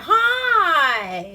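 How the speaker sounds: high, loud, bright-eyed greeting; a mock beach girl calling out Hi; bubbly hi. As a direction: chirp a big hello at him. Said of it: "And she's like".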